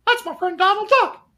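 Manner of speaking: very high, loud falsetto Mickey; Mickey Mouse. Retold or imitated. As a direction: squeak it like a half-ass Mickey. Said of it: "I could do a half-ass Mickey".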